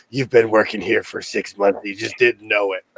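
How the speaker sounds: deadpan boss voice. Retold or imitated. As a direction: hand over the keys deadpan. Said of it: "were like".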